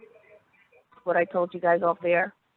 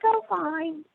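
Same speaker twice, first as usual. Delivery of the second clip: lightly voiced woman; 11 semitones higher than usual. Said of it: "He's like".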